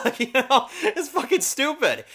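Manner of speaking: high, laughing through the words; can't stop laughing while talking. Real, not acted. Reviewer cracking up at his own bit, laughing.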